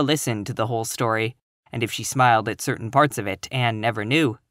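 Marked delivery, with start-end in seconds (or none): none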